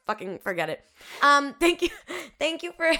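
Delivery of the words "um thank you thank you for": laughing thanks. Real, not acted. Sarcastic thanks while laughing.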